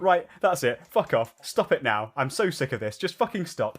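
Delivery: loud fed-up mock yell; imitated yelling. Friend telling off the face in his dream, retold yelling. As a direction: act out his exasperated shouting. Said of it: "I could hear him from my room just yelling".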